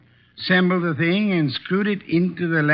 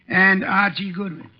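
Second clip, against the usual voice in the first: normal talking voice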